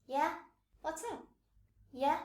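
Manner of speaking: "Yeah" rising in a casual question; answering a friend's call. Acted. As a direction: respond with an upswept "Yeah". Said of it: "a friend calling out to you".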